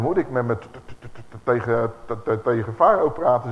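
repeats every "t-" before "tegen" and stammers through "met, met"; stuttering Moses. Retold or imitated. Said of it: "Mozes had een beetje een stotterende stem". (stotterend, hakkelend op "t-, t-, tegen")

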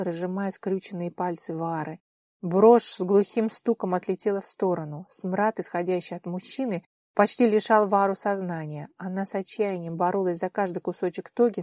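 ordinary delivery, nothing marked

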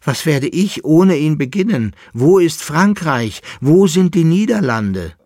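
high, loud, mounting despair; despairing lament. A narrator's reading. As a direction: bewail it, rising to desperate cries. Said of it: "stöhnte der Leitnitzer". (verzweifelt klagend, immer lauter)

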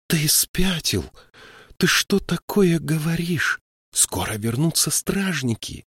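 urgent, trying to hide her fright; barely held fear. A narrator's reading. Siskwi urges the troll out of the pit, barely hiding fear. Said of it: "с трудом сдерживала страх".

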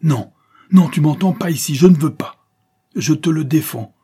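loud, high, vehement protest; desperate forceful shout; clamouring refusal. A narrator's reading. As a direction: forbid it at the top of your voice. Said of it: "clamait Morales en resserrant son étreinte".

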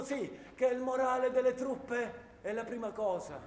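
slow, mocking parody of an official's public speech; lightly voiced smug politician, mocking; mock pompous official. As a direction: ape the pompous words of the leaders. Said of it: "Gli piace dire in pubblico".

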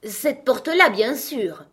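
high, loud, exasperated retort; irritated. A narrator's reading. Irritated Alice answers the slow frog, high and sharp. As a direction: snap it high and loud, then drop. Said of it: "qu'Alice, tout irritée".

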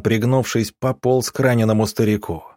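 ordinary delivery, nothing marked

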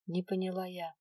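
plain reading voice

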